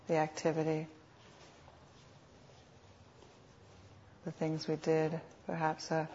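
unhurried meditation guide, long pauses between phrases; guided meditation. Real, not acted. Teacher guiding sitters to notice what is happening, calm meditation voice. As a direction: lead them gently, phrase by phrase, leaving space.

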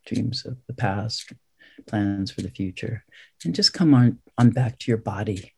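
soft, spacious meditation voice; guided meditation. Real, not acted. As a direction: leave long silences between short phrases. Guides breath counting in meditation.

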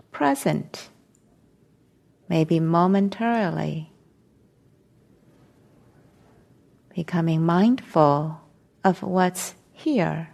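slow meditation guidance with long silences between phrases; guided meditation. Real, not acted. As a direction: ease them into stillness, phrase by phrase, unhurried.

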